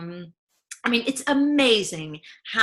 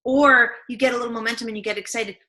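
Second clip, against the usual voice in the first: ordinary delivery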